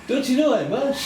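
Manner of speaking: bad, high, loud Welsh accent. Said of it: "Was that your Welsh accent?".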